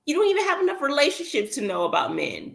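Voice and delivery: high and fast, incredulous; high, quick disbelieving scolding; exasperated adult. Retold or imitated. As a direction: scold a know-it-all kid in disbelief. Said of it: "and you're like".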